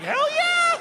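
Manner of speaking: high, loud whoop; triumphant shout. Retold or imitated. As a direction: yell it like a victory cry. Triumphant yell about John Henry.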